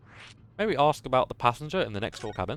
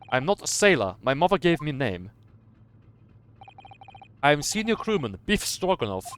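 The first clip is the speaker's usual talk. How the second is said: louder, proud gruff crewman, lightly voiced; lightly voiced sailor; 2 semitones higher, 5 dB louder than usual. The crewman proudly corrects Naruhodo, lightly voiced Russian sailor. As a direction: announce your name with pride, a hint of Russian.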